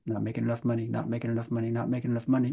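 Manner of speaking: soft, repetitive murmured worry; quiet nagging refrain. Retold or imitated. Muttering the money worry that loops in the mind.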